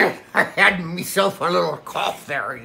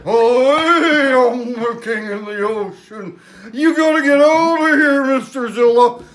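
orca king voice; 7 semitones higher, 4 dB louder than usual. Acted. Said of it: "the orca whale came up and said".

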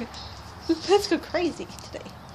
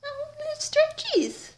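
high-pitched doting coo; 10 semitones higher than usual. Real, not acted. Admiring the pet as it stretches, high cooing. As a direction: coo up high at a tiny creature.

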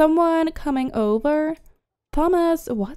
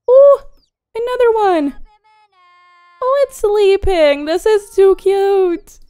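high, loud squeals over something adorable; squealing delight; 7 semitones higher, 7 dB louder than usual. Real, not acted. Player squealing at tiny sleeping animals, high and loud. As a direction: gush like you just saw a puppy.

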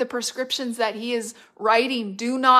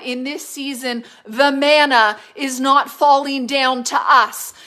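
louder, driving preacher's cadence; preaching at full force; 4 semitones higher, 8 dB louder than usual. Acted. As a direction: proclaim it like a revival preacher. Preaching to the congregation.

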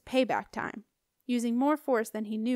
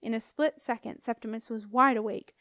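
ordinary delivery, nothing marked

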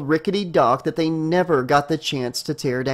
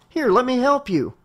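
put-on helpful young man; 8 semitones higher than usual. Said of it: "the young man said".

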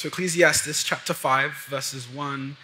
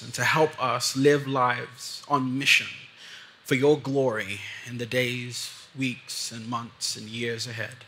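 soft, low prayer voice; 3 semitones lower, 4 dB quieter than usual. Acted. Pastor praying for the congregation, soft and low. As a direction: pray gently over the people.

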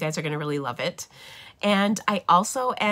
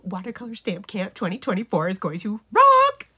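playful build-up to a loud, high ROCK; passionate hype; 4 semitones higher, 5 dB louder than usual. Real, not acted. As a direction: hype it up, then belt out the last word. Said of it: "can you tell I have some passion".

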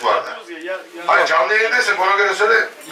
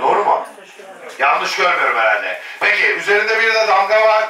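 ordinary delivery

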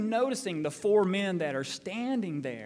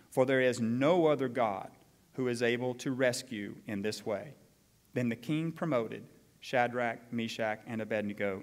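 measured, formal Bible reading; scripture reading; 5 semitones lower than usual. Acted. Pastor reading the decree to the congregation, formal and measured. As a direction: read the king's decree with weight.

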